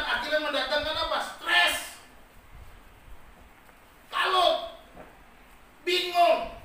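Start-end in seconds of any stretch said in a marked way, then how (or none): 1.2-6.6 three words each shouted higher and louder; punched outcry. Acted. Preacher hammering the results of fear to the congregation, loud. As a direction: hammer each word home to the crowd.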